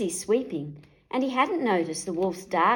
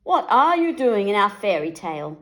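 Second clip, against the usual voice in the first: scowling; 7 dB louder than usual. A narrator's reading. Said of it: "scowled Mother Bear".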